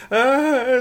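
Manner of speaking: put-on high, very loud old lady's scream; terrified scream. A narrator's reading. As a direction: shriek in sudden fright. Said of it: "screamed the old lady".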